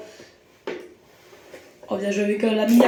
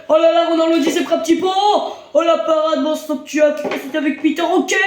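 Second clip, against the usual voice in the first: excited sports commentary; 4 semitones higher than usual. Acted. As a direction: shout the action like live TV. Goal call commentary.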